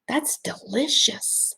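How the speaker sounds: slow delighted whisper. Acted. Savoring the group's energy, slow whisper. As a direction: breathe it out in a whisper.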